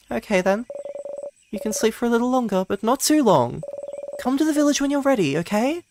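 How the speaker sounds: put-on high, fast, light friendly girl voice; gentle teasing wake-up from a friend; cheerful friend. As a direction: tease a sleepy friend fondly. Voicing Mirabelle.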